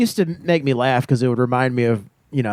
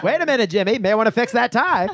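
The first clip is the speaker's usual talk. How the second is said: put-on mid-Atlantic accent; 9 semitones higher than usual. Old-timey ad bit.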